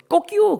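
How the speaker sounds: high mock rooster crow. Preacher imitates the rooster again, high crow. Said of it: "그 소-, 소리에".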